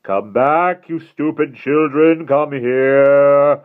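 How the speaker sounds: high, loud, menacing call, stretched out; commanding shout. Acted. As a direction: order the children back across the water. Villain calling across water.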